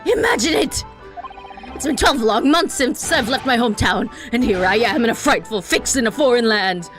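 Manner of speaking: grand, loud dramatic lament; theatrical prisoner voice. Acted. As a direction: declaim it like a stage tragedian.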